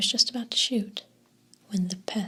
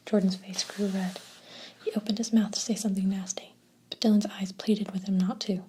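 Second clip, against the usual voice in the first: usual speaking voice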